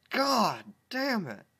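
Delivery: slow, high loud curse; frustrated outburst. Real, not acted. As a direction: blurt out in frustration. Outburst at fic.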